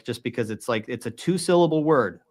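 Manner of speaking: ordinary talk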